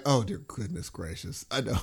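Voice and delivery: a lightly voiced mortified son groaning; horrified son. Son hearing the podcast, mild mock horror.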